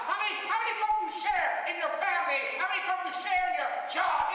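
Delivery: high, loud, shouting sermon; full-force preaching. Acted. Preacher warning church about doubters, shouting. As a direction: call it out to the whole house.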